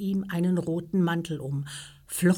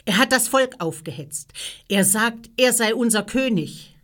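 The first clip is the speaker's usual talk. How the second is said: raised, higher accusing voice; loud accusation; 6 semitones higher, 6 dB louder than usual. A narrator's reading. Priests accusing Jesus before Pilatus, calling out. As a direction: call the charge out to the judge.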